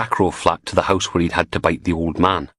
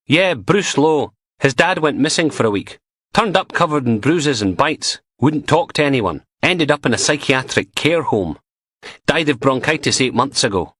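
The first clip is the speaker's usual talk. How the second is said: a higher, lightly voiced young constable, eager to share news; chatty constable on the phone; 7 semitones higher than usual. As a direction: play him keen and gossipy, a touch higher. Constable continues the report.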